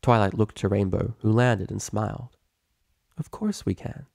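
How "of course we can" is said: gentle forgiveness. Acted. Tender forgiving reply.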